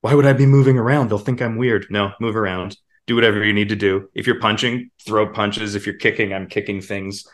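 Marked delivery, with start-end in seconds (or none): 0.0-1.9 a hint of a nervous newcomer in the booth; louder, worried inner voice; self-conscious beginner. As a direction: beginner afraid of looking weird. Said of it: "you don't wanna be".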